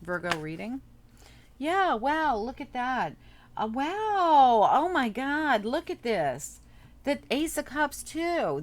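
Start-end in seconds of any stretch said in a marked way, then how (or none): 1.6-8.6 amazed. Real, not acted. Amazed at the cards drawn.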